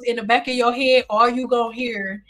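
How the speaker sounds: usual speaking voice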